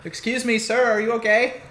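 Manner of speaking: high-pitched cartoon foreign accent; high, comic Bartok-style foreign accent. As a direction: play a concerned helper in a Bartok accent. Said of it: "What fucking accent was that?".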